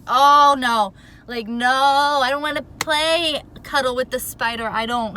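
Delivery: loud, frightened protest at a spider; panicked yelling. Retold or imitated. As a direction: yell it in panic, pleading. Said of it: "I'm freaking out".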